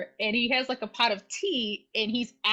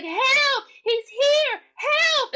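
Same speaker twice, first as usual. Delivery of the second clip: very high, loud mock scream for help; mock frantic damsel shriek; imitated screaming; 6 dB louder, much slower than usual. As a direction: scream across the street, hammed up. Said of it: "she's screaming to the cops".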